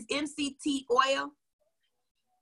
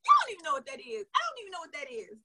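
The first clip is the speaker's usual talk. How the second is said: laughing; 8 semitones higher, faster than usual. Retold or imitated. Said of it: "I crack up laughing going".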